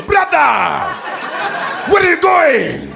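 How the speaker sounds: high, loud and slow with a laugh; mock local guy calling out. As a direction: call out to him through the car window, laughing. Scooter guy pops up at window.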